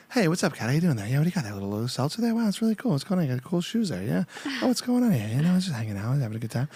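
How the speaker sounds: chatty celebrity patter, pitch hopping up on questions; Mark Wahlberg. Acted. Comedian reviving an early bit, casual Mark Wahlberg impression. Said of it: "I did Mark Wahlberg one".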